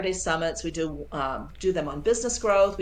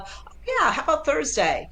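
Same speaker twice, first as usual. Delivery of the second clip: mock client, high, bright yes; lightly voiced client picking a day; imitating an agreeable client; 8 semitones higher than usual. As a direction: play the client who happily agrees.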